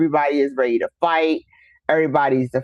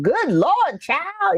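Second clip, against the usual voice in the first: mock country exclamation, pitched high; a hint of a country aunt; 11 semitones higher, much slower than usual. Imitating her country aunt greeting her. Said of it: "you know, country".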